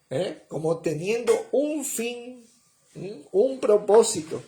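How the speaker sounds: usual speaking voice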